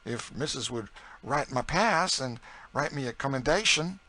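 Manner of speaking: high, lively folksy dialect; eager Southern cook. A narrator's reading. Narrator voices Chloe eager to go.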